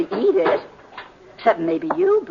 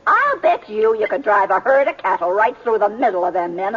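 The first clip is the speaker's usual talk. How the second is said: ordinary delivery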